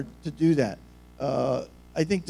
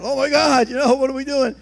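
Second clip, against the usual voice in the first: high, loud mock panic; freaking out; 8 semitones higher, 5 dB louder than usual. Hungry family when the pot stops boiling, freaking out. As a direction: wail it in exaggerated alarm. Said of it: "We're freaking out".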